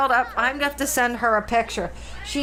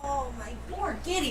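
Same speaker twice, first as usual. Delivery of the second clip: high, soft sympathetic coo; pet talk; 4 semitones higher, 7 dB quieter than usual. Real, not acted. Owner soothing her storm-scared cat, soft high coo. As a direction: coo softly to a scared kitten.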